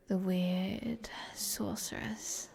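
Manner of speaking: slow, soft, hushed whisper; mock spooky whisper. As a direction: whisper the nickname slowly, half mocking. Naming what the townsfolk call her.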